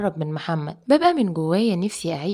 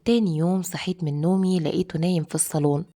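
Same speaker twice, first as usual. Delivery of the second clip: ordinary delivery, nothing marked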